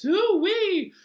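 squealed very high, loud hoo-wee; a gleeful falling whoop. Acted. Wiggling hips in the chant, squealed whoop.

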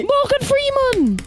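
high, drawn-out exclamation; triumphant punchline cry. Pretend. Gamer answering their own riddle, high triumphant cry. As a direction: sing out the answer high and slow. Shouted punchline of name joke.

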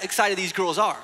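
ordinary talk, nothing marked

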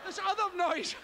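ordinary delivery

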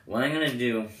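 normal talking voice

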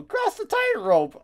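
a pitch that breaks upward; squeaky cracked read of the sign; voice cracking high. Real, not acted. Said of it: "my voice cracked".

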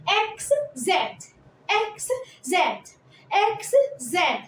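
slow, drawn-out letter drill; slow repeated classroom drill. Shown as a demonstration. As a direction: spell it out slowly for the kids.

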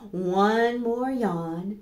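slow, yawning delivery. Acted. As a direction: yawn the words out. Reading bedtime book, yawning.

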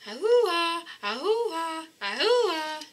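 high drawn whale calls; whale family call. Acted. Calling the humpback family to make waves, high whale call.

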